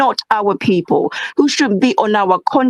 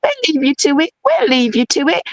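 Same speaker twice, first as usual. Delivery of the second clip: higher, lightly mocking shrug of a voice; mock resigned giving up; 6 semitones higher than usual. Mocking her people backing off from a fight, resigned. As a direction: hand it over with a sing-song sigh. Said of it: "So once we're like".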